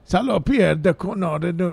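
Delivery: high mock French swearing; stumbling high-pitched French accent. Cohost attempting the French swearing trivia, high faked French accent. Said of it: "that's my French accent".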